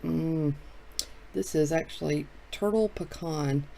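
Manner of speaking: usual speaking voice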